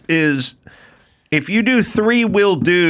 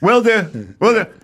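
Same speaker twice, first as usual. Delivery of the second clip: higher, hurried goodbye voice; wrap-up phone voice; 5 semitones higher than usual. Retold or imitated. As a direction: close out the call politely. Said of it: "They'll start getting wrapped".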